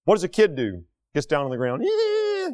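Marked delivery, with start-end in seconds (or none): 1.8-2.5 mock tantrum wail. Voicing a kid's tantrum.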